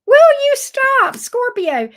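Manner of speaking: loud, high, drawn-out plea to stop; exasperated scolding. Real, not acted. Reader cutting off a doubting client, exasperated. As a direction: scold with long, high, heavy words.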